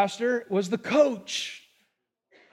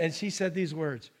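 ordinary delivery

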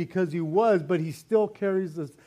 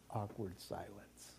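hushed; 9 semitones lower, 21 dB quieter than usual. Real, not acted. Stressing heaven's awkward silence.

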